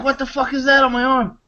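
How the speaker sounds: high-pitched imitation of alarm; mock freak-out. Voicing the freaked-out guy.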